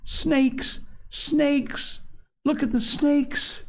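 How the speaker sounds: put-on panicked kids shrieking about snakes; little boys screaming. A narrator's reading. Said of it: "The little boys screamed at them".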